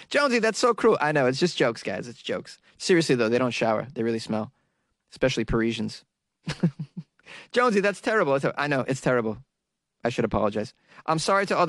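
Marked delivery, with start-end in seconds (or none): 0.1-1.0 high-pitched mock outraged listener; scolding listener. Offended listener scolding the podcaster over jokes, mock outrage. 7.5-8.3 scolding listener. Acted. Voices an objecting listener.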